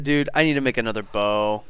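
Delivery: ordinary talk, nothing marked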